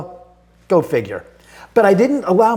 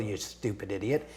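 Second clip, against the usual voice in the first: self-scolding mutter; 9 semitones lower, 16 dB quieter than usual. Retold or imitated. Scolding himself in retelling.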